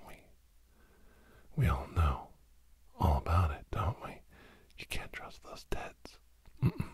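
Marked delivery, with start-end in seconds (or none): none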